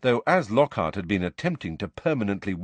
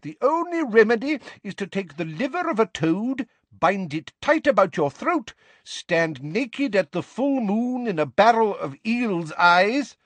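put-on higher, pompous old-fashioned declaiming; medieval healer; 11 semitones higher, 4 dB louder than usual. A narrator's reading. Portrait healer pronouncing doom to a boy, pompous. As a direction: proclaim the diagnosis grandly.

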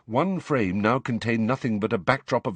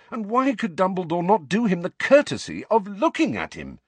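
ordinary talk

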